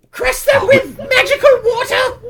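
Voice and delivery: loud, very high kobold accusation; Kakarol. Acted. Kobold leader accusing the party, loud high creature voice. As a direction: squeal the charge as a small dragon lord.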